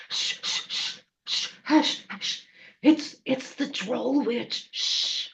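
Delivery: hissed, whispered shushing; frightened whisper to keep someone silent; urgent hushing. Acted. Sister or brother hushing the crying little one, urgent whisper.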